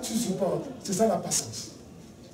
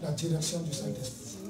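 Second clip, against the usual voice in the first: softer, unhurried calm reply; 5 semitones lower, 12 dB quieter than usual. Retold or imitated. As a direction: answer with quiet composure. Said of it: "tu es calme et tu dis". (calme et posé, plus doux)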